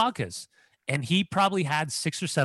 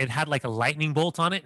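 usual speaking voice

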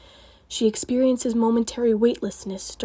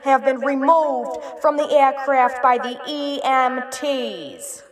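squawking flight attendant; 3 semitones higher, 4 dB louder, slower than usual. A narrator's reading. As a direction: squawk it out slowly over the PA. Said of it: "squawks a flight attendant".

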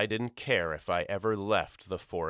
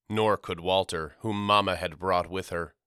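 ordinary delivery

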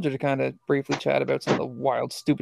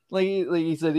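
ordinary talk

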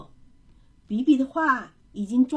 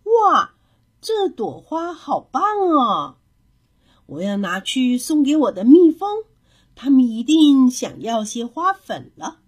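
delighted admiration; 3 semitones higher, 9 dB louder than usual. A narrator's reading. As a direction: gush loudly over a child's picture. Neighbour admires the child's picture. (大声惊喜地赞叹)

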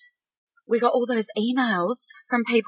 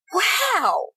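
much higher, slow, amazed; surprised realization; 13 semitones higher, much slower than usual. Shown as a demonstration. Inner voice discovering its worth, amazed and surprised. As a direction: gasp at the discovery. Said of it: "a bit of like".

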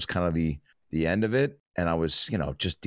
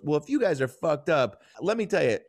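high-pitched imitation of a woman; imitated gal; 10 semitones higher than usual. Gal gives tripping strangers a tip, imitated high voice. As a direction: raise the voice to play the gal. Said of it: "And then the gal goes".

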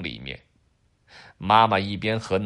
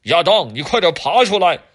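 loud, higher calling out; 7 semitones higher, 11 dB louder than usual. Acted. Dad calling down the drain to Adam, loud call.

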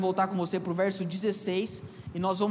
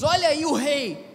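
higher, louder sneering call; mocking jeer; 6 semitones higher, 5 dB louder than usual. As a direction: taunt him like a soldier laughing at a fake king. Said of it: "como quem diz". (zombaria alta e debochada)

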